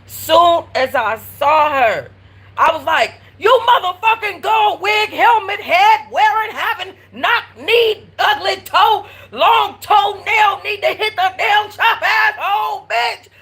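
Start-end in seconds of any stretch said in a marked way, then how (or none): 3.4-13.3 going off. Retold or imitated. Narrator reenacting going off on the neighbor, high shouted tirade. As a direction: scream a string of insults at the neighbor, laughing through it. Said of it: "I just went off".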